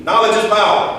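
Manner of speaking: loud, high, rallying call; call and response. Shown as a demonstration. Teacher leading students to chant the motto loudly. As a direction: lead the class chant with energy. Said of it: "Repeat this with me".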